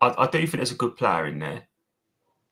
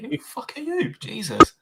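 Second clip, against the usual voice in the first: mock outraged retort, much higher; a put-on affronted player, sharply higher then louder; indignant player; 4 dB louder than usual. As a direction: voice the player scoffing at the pundit. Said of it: "he's probably thinking".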